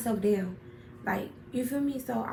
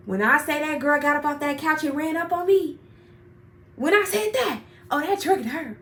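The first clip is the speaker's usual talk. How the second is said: louder, higher, rushing to the fight; building up the big moment; excited climax; 5 semitones higher, 5 dB louder than usual. Real, not acted. Telling friends how the fight started, excited build.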